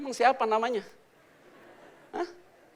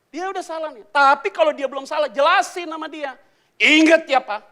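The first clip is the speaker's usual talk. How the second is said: loud, high, building preaching; fiery sermon voice; preaching at full force; 10 semitones higher, 13 dB louder than usual. Acted. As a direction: drive the story home from the pulpit.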